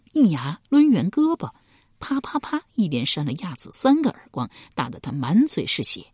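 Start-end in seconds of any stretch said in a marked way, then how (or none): none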